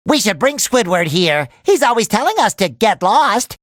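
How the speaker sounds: ordinary talk, nothing marked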